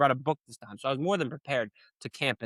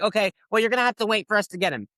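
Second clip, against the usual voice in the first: high, faster, lightly mocking official voice; mock prosecutor; 6 semitones higher, faster than usual. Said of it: "And you're like".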